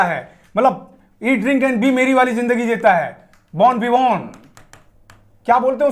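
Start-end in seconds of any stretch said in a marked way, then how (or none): none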